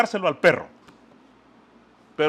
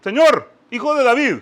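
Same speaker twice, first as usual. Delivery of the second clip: raised, higher call to the Lord; retold shout; 5 semitones higher, 5 dB louder than usual. Woman calling after Jesus who ignores her, retold shout. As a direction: call out louder, as if after him. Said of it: "la mujer le gritaba".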